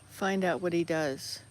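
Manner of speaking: ordinary talk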